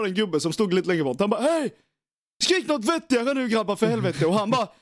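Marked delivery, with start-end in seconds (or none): 1.4-4.3 higher-pitched imitation of a telling-off; mock scolding. Older man nearby to the shouting lads, mock scolding. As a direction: play a bystander calling the lads to order.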